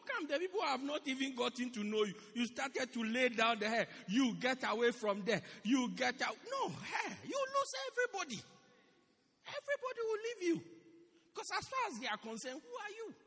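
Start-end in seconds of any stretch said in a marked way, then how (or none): 4.1-10.6 loud, rising rebuke ending in a shout; harsh dismissal. Shown as a demonstration. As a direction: drive them away sharply. Warning harsh pastor.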